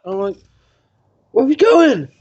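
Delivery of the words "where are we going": high, loud bewildered question; panicked question. Retold or imitated. Asking the crew where they're going, high alarmed. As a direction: demand to know in alarm. Confused retold quote.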